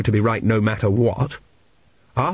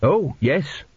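mildly unsure yes; hesitant; 3 semitones higher than usual. A narrator's reading. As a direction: agree with a touch of hesitation. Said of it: "said Teppick hesitantly".

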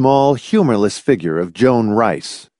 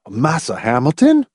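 mock-servile Southern dialect, higher; burlesque Southern dialect; 5 semitones higher than usual. A narrator's reading. Laws taunting Hamilton, burlesque Southern dialect. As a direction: put on a mocking Southern dialect. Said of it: "Laws burlesqued".

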